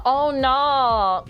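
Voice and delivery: mock Aussie meme quote; lightly exaggerated Australian vowels; Australian accent. Streamer explaining where the meme came from, imitated Australian accent. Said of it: "meme came from".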